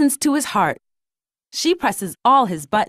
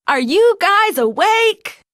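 high, loud wake-up yell; Louie; 9 semitones higher, 5 dB louder than usual. A narrator's reading. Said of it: "he yells".

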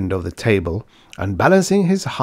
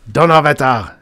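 loud, high peppery curse; angry shout; 7 semitones higher, 7 dB louder than usual. A narrator's reading. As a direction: explode at the interruption. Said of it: "shouted von Althaus".

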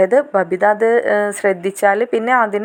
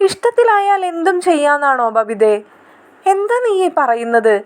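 scolding; 7 semitones higher than usual. Acted. Sister scolds younger sister.

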